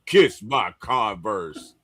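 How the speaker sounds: mock movie villain taunt, building to a shout; Last Dragon villain quote. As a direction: ham up the movie villain's swagger. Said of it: "The Last Dragon".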